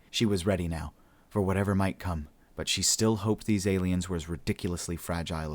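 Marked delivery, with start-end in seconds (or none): none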